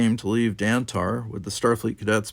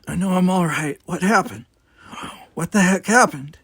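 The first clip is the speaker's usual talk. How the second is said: higher, dazed woman's voice; a shaken young woman, just pulled out; dazed Tanya; 8 semitones higher, 4 dB louder than usual. Acted. Tanya asking Worf what happened, dazed and high.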